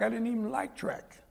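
normal talking voice

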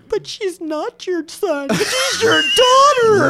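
high, drawn-out wail rising to a shout; hammed-up sobbing protest; mock crying. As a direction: imitate someone bawling through the words. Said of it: "he starts crying".

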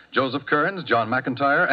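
usual speaking voice

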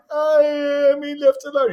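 very high, loud imitation of a whining little boy; mock child's wail. Six-year-old husband left alone in the car, mock wailing. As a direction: wail like an abandoned kid, hammed up.

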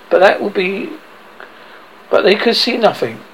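ordinary delivery

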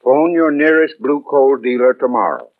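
usual speaking voice

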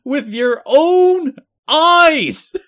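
high, drawn-out bellow; A J Styles impression. Co-host hollering about planets, high mock-outraged yell. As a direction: holler it slowly, word by word, outraged. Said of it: "you did it in the A J voice".

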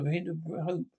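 normal talking voice

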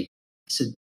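ordinary delivery

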